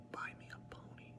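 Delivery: sneaky whispered joke request; mischievous whispered aside. Pretend. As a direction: slip it in as a whisper.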